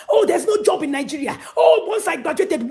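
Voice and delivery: high, loud, fast mock complaint; mock whiny excuse. Mimicking young men's excuses, high and loud. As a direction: parrot the lazy excuse.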